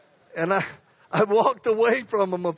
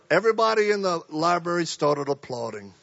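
ordinary talk

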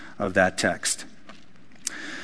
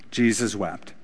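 soft repeat; 5 semitones lower, 8 dB quieter than usual. Shown as a demonstration. Repeating the verse, softly.

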